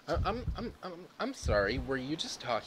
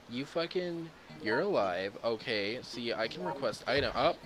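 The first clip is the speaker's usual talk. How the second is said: ordinary talk, nothing marked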